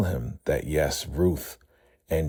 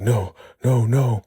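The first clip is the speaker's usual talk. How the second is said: frantic no; 7 semitones higher, 5 dB louder than usual. Retold or imitated. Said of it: "Joan is like".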